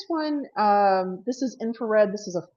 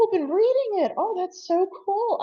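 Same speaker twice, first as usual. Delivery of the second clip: high, gushing delight; real excited delight; delighted surprise; 12 semitones higher than usual. Real, not acted. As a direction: squeal over good news. Surprised by book readers live.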